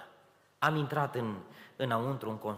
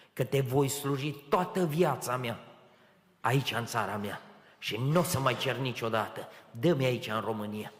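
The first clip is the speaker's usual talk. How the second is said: a prayer building louder into a firm vow; fervent vow; 6 dB louder than usual. Retold or imitated. Man vowing to God in the parking lot, growing fervent prayer. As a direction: promise God everything, gaining strength as you go.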